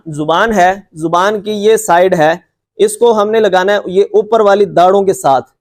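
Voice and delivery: ordinary delivery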